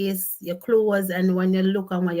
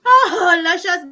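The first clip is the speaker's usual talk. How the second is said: laughing; 9 semitones higher, 9 dB louder, slower than usual. Real, not acted. Still laughing.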